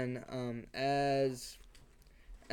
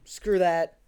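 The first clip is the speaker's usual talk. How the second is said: mom giving up; 4 semitones higher, 8 dB louder, faster than usual. Retold or imitated. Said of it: "she's just like".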